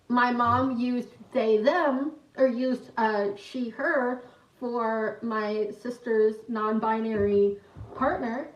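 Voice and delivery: usual speaking voice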